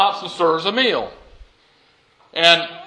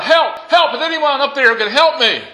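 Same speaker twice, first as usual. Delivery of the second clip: high, loud cry for help; calling out for help; 10 semitones higher, 4 dB louder than usual. Acted. Man dangling from a cliff, shouting up for help. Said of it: "And he called out".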